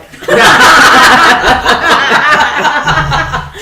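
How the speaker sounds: long loud burst of laughter. Real, not acted. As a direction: crack up loudly. Big laugh.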